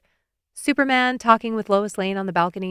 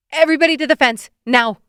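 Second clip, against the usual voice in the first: loud, high barked order; command mode; 7 semitones higher, 7 dB louder, faster than usual. Acted. Narrator ordering kids away from the choking boy, loud command. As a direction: order the kids back like a drill sergeant. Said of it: "My voice cracks into command mode".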